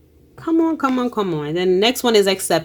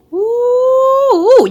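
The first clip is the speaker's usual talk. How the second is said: excited ooh; 6 dB louder than usual. Real, not acted. Excited reader reacting to cards.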